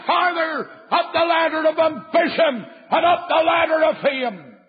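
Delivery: pulpit-pounding revival preaching; preaching at full force. Acted. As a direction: thunder it at the young sinner. Describing Pilate's ambition.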